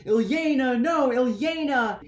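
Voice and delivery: high, loud wail of grief; high loud anguished cry, slowing on no. Acted. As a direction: cry out for his dead wife. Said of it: "he's super upset about it".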